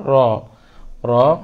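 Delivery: র said with rounded lips, not রা; র with a round mouth. Shown as a demonstration. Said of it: "রা উচ্চারণ করবো না".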